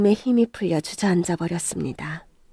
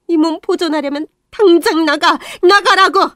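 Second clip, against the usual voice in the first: yelling get out; 7 semitones higher, 8 dB louder than usual. Acted. Mother throwing the girl out, building to a shout. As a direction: drive her out of the house.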